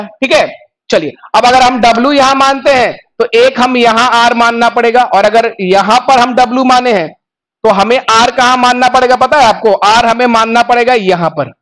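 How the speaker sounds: ordinary talk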